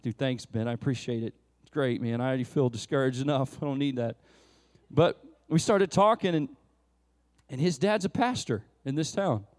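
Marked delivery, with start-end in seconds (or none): 0.0-4.2 low, soft, dry sarcastic thanks; muttered mock-gloomy thank-you; deflated mock thanks. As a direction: sound beaten down for a laugh. Speaker voices his own deflated reply.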